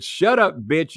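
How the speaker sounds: high, loud mock snap; high, loud retold snarl; retold angry snap. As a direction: cut her off sharply. Brock snapping at girlfriend.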